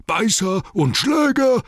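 very high, drawn-out orc yell; roaring. A narrator's reading. Orcs recognising the dreaded swords, roaring in alarm. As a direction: bellow it slowly as a horrified horde. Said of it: "brüllten sie".

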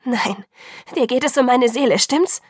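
words bursting out, accusing; angry outburst. A narrator's reading. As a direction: call it out angrily, still pleading. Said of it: "rief ich zornig".

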